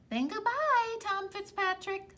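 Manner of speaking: high sly farewell; leprechaun voice. Acted. Leprechaun bidding Tom farewell, high sly voice. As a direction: say goodbye with a sly lilt.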